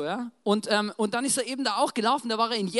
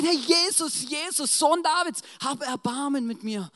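raised, urgent plea, strongest at the start; crying out; 5 semitones higher, 4 dB louder, slower than usual. Acted. Blind beggar begs Jesus for help, crying out. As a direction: call out for mercy, then plead.

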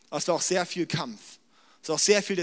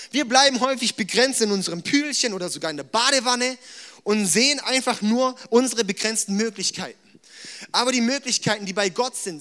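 raised, urgent sermon voice; fervent preaching; 4 semitones higher, 3 dB louder than usual. Acted. Preacher urges the congregation about impossible goals, fervent preaching. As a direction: push the point home to the church.

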